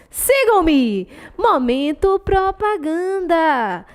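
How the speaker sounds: mock ad jingle with stretched-out words; sing-song plug, slow and drawn out; anúncio de propaganda. (locução cantarolada de propaganda)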